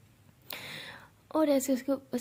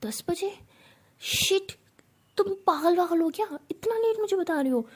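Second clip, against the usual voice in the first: high, louder shocked boss; jolted by the time, she blurts it out; shocked outburst; 7 semitones higher, 4 dB louder than usual. Acted. Boss shocked at late reminder. (बॉस secretary पर चौंककर ऊँची आवाज़ में बोलती है)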